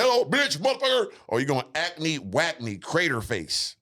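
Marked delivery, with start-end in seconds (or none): 0.0-1.1 high, blurted curses; hammed-up high outburst; Tourette's girl. Mocking a girl with Tourette's, high blurted swearing. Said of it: "Or the bitch like".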